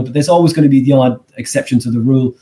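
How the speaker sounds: usual speaking voice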